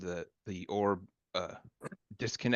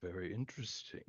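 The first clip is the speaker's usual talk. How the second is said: soft, low-key character murmur; hushed, intrigued aside to oneself; villain musing privately; 3 semitones lower, 9 dB quieter than usual. Acted. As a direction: muse quietly to yourself as you watch. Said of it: "he looks at it and he goes".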